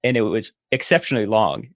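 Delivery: usual speaking voice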